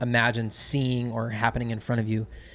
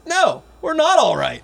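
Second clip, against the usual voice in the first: slow, loud, higher retold scolding; stern scolding; 6 dB louder, slower than usual. Captain scolding drunk clients, loud and stern. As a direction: lay down the law, slowly.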